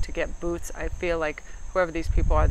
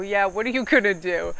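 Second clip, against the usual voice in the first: defiant taunt; 3 semitones higher, 4 dB louder than usual. Retold or imitated. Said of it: "stare at him and go".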